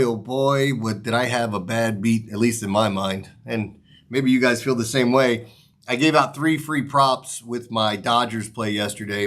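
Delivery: ordinary talk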